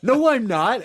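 loud, high mock protest; high loud denial. Shooter denying it to the victim, loud high denial. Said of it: "He's like".

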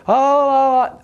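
high, drawn-out nervous "oh"; mock-frightened high exclamation; scared. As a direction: act out getting spooked. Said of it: "you kinda get scared".

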